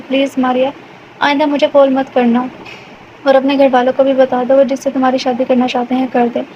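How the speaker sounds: usual speaking voice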